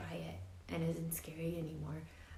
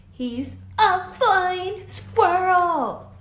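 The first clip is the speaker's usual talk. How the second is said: slow, much higher, louder exclamation; triumphant reveal; 11 semitones higher, 15 dB louder, much slower than usual. A narrator's reading. Teacher revealing the twist to her class, loud and delighted. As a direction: announce the surprise with delight.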